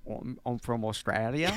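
put-on tentative Australian accent. Contestant attempts the requested accent, Australian accent.

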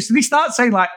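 ordinary talk

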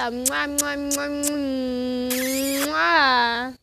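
smacking air kisses; kissing sounds. Real, not acted. Blowing kisses to self.

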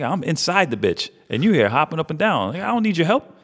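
high, climbing imitation of an exasperated friend; outraged protest. Friend scolding the buddy who bounced on him, outraged.

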